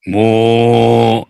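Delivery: long, low imitated moo; cow moo. Joking about riding a cow, low moo.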